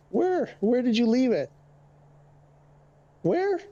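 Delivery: pet talk. Real, not acted. Streamer asks his cat where it pooped, high baby-talk voice. Talking to the cat about poop.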